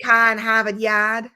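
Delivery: slow mock Boston accent. Riffing on Boston, exaggerated Boston accent. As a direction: play up a broad Boston accent. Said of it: "I can't say Boston without Boston".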